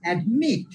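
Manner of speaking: Admit, rising to a stressed মিট; light first syllable, strong মিট in admit; second-syllable stress. Shown as a demonstration. Teacher repeating for students, second syllable of admit stressed. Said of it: "second সিলেবলে pressure দিব".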